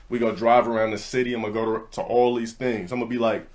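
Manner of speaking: ordinary delivery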